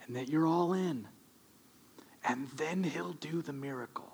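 hushed appeal. Real, not acted. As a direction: drop to a near whisper for the congregation. Preacher drops voice, intimate appeal.

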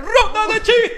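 high, loud announcer call; booming announcer; game-show shout. Acted. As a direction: hype up the segment.

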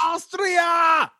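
shouted high in a hammed-up British accent. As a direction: bellow "Austria!" like a posh Brit. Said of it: "In a British accent".

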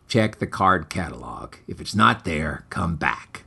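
very low, soft and flat brush-off; low, quiet offhand librarian; bored librarian. A narrator's reading. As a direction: librarian answering without looking up from his book, low. Bored librarian without looking up.

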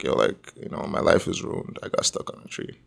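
low, soft, near-whispered mock despair; half-whispered imitation of crying; retold crying. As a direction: imitate yourself sobbing that life is ruined. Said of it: "I was crying, like bad".

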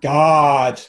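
put-on loud groaning teen "God"; exasperated teen. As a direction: sigh it like an annoyed teenager. Voicing a teenage cat.

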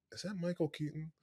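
very soft, discreet mock murmur; hushed aside. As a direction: retell it as a secretive aside. Retold whispered aside at the game.

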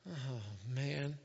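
low, soft weary lament; aggravated, dejected. Acted. Moses hearing all the complaints, low dejected groan. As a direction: sigh it out, worn down. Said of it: "Moses is aggravated".

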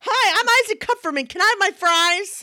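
put-on very high, proud little-kid voice; little Isaac introducing himself. Boy introducing himself at the drive-thru, high and proud. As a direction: announce yourself like a tiny VIP. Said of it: "He says it everywhere we go".

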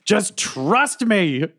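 insistent. Acted. Character insisting to Quinny.

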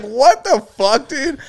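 high, drawn-out disbelief; mock appalled. Son hearing what mom overheard, mock appalled. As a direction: stretch it out in pained, high-pitched protest. Son's shocked reaction, retold.

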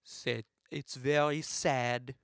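slow, mock-French mime dialect; slow French-accented interpreter. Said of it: "He's doing a French accent".

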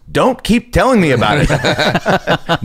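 slow, high-pitched mock exasperation; stretched-out, pleading annoyance; exasperated. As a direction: imitate a fed-up casting man, dragging it out. Casting man fed up in retelling.

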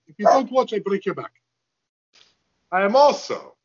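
high, hammed-up wrestler menace; Iron Sheik threat. Shown as a demonstration. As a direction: threaten like a cartoon heel. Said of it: "then do your Iron Sheik".

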